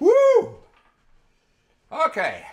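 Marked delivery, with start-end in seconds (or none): 0.0-0.5 high loud whoop; excited whoop. Real, not acted. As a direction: celebrate with a big high woo. Cheering at six minutes.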